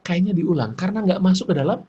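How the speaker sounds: ordinary delivery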